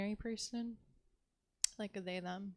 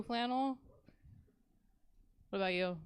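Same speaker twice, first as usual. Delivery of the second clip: ordinary talk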